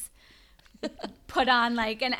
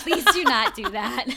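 laughing protest; 5 semitones higher, 10 dB louder than usual. Real, not acted.

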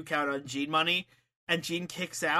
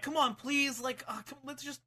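higher, wheedling apology; mock pleading; 7 semitones higher than usual. Money begging his angry life partner, mock pleading. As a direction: beg a cross partner to relent, hammed-up.

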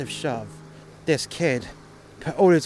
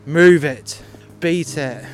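much louder, forceful command; retold barked order; 2 semitones higher, 11 dB louder, slower than usual. Russian driver sending the kid off, loud barked order. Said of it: "he was like".

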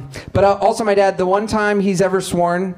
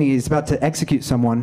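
ordinary talk

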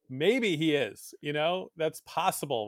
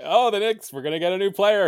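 higher, louder hopeful buzz; excited dad explaining; 5 semitones higher, 6 dB louder, faster than usual. Retold or imitated. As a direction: hype up your little son. Acts excited talk to his young son.

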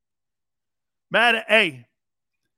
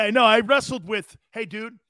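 normal talking voice

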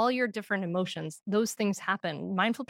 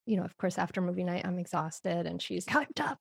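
usual speaking voice

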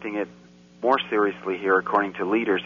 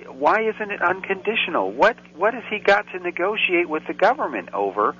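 high-pitched, incredulous outrage; rising disbelief as the rant builds; pitched up high with real indignation; indignant rant; 9 semitones higher than usual. Real, not acted. Host ranting about a fraudster's plea deal.

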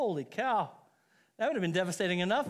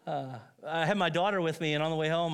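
ordinary talk

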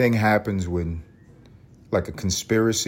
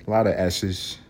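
ordinary delivery

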